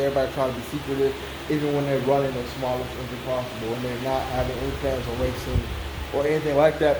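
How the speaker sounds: ordinary talk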